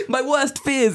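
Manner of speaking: high and loud panicked cry. Retold or imitated. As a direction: blurt it out in a panic. Quoting panicked sparring partner.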